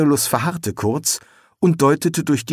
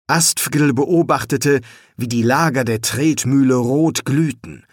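plain reading voice

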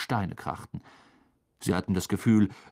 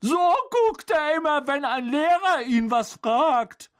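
high, raised character voice; Ron amused; 4 dB louder than usual. A narrator's reading. Ron compares Harry to Goyle, high and lively. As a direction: explain it eagerly, pitch well up.

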